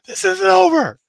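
high, loud hysterical scream. Acted. [name] screaming into the camera after losing, hysterical. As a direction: shriek it like a sore loser losing it. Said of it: "He's screaming into the camera".

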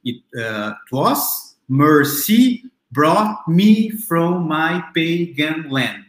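slow, syllable-by-syllable verse reading; slow, separated syllables, as if counting them off. Shown as a demonstration. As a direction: beat out "was mercy brought me from my pagan land" one syllable at a time. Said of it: "Eu posso contar aqui".

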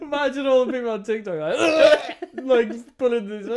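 high, laughing through the words; giggling at the image. Real, not acted. Laughing picturing TikTok.

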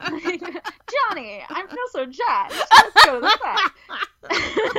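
high, loud glamorous starlet voice; old Hollywood starlet. Pretend. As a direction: play a dramatic golden-age actress. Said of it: "an old Hollywood starlet".

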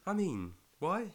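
shocked disbelief, pitch jumping up; surprised. Real, not acted. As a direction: gasp then a sharp high why. Gasps at friend's sad analytics.

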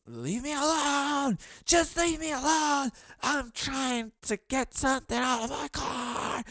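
imitated scream.

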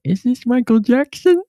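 high, put-on sitcom boss voice on the phone; Michael Scott impression. Caller pranking an office, in a high Michael Scott voice. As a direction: play the clueless boss asking it straight. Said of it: "where Michael Scott calls and he's like".

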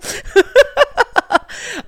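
high, loud burst of laughter; real laugh breaking out; big laugh. Real, not acted. Laughs at being tall.

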